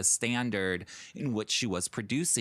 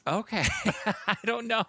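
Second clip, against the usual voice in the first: laughing; 11 semitones higher than usual. Real, not acted. Laughs while answering.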